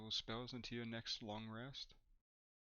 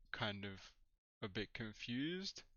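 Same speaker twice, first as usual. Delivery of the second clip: normal talking voice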